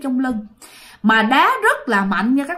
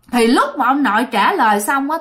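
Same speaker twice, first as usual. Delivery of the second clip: plain reading voice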